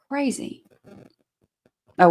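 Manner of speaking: ordinary talk, nothing marked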